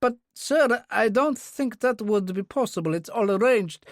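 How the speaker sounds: faltering. A narrator's reading. The aide objects to Kurbsky's seating plan, faltering. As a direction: protest deferentially, ill at ease. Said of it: "The aide faltered".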